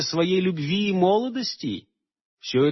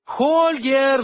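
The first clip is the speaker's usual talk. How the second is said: high ringing call across the clearing; 9 semitones higher than usual. A narrator's reading. Aleonora calling for Holger from her unicorn. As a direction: call his name from afar. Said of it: "звала она".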